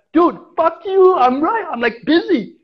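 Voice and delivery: irritated retort to a coworker while climbing; angry snap. Retold or imitated. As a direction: bark it back down the tree, annoyed at being bothered. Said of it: "And I'm like".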